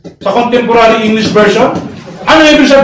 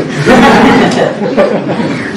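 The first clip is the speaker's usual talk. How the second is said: laugh; 4 semitones lower than usual. Real, not acted. Laughing at the argument.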